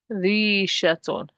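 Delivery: slow rishatun with held "rii"; long I vowel. Shown as a demonstration. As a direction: stretch the first vowel of rishatun. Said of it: "Kasra with the ya before it".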